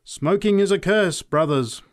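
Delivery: loud, high denunciation; fervent street-preacher proclamation; preacher's cry. A narrator's reading. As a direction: cry it out to the crowd. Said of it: "cried the preacher".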